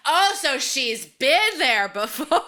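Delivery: high, loud, slow dawning surprise; surprised realization. Retold or imitated. Co-host retelling her reaction to the scene, in slow high surprise. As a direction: say it as the penny drops. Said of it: "I literally said out loud, I went".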